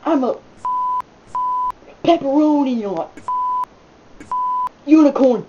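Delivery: loud high hiccup yelps between words. Real, not acted. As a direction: hiccups jolting each word. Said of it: "It's getting really annoying".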